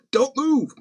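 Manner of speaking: high-pitched mock warning; high, joking caution. Jokes at python headline, high voice.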